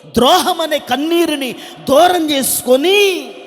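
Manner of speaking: loud, high preacher's exhortation; preaching at full force. Acted. Preacher urging the congregation to weep, full force.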